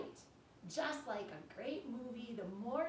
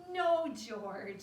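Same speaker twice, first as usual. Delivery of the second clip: louder firm no; mother scolding; 8 dB louder than usual. Acted. Mother telling George no, firm. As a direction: correct him like a mother. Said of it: "said George's mother".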